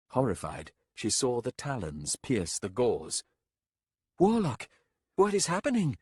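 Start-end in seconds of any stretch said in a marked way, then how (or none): 4.2-6.0 high, loud frightened cry; alarmed call. A narrator's reading. Captain crying out to the warlock, high frightened call. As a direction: shout it in horror.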